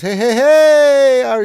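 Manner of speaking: very high, loud, slow hey hey hey; hyped greeting. Acted. Streamer voicing a viewer's hello, loud drawn-out hype. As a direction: shout it like a hype man. Reading a chat greeting aloud.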